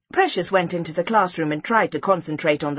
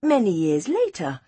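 usual speaking voice

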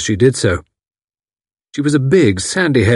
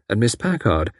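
ordinary delivery

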